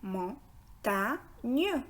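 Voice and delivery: "Montagne" split as mon, ta, gne; syllable by syllable. Shown as a demonstration. Teacher shows learners the syllables of "Montagne", syllable by syllable. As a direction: pause after each syllable of "Montagne". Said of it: "regardez très bien les syllabes".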